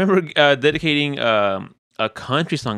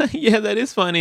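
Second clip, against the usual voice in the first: higher voice through laughter; forced laugh; 11 semitones higher than usual. Retold or imitated. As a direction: laugh along half-heartedly. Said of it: "I was like".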